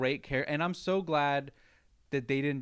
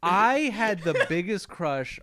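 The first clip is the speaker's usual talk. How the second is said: loud high laughing talk; 8 semitones higher, 11 dB louder, slower than usual. Real, not acted. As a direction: laugh through the words. Laughing about celebrity crush.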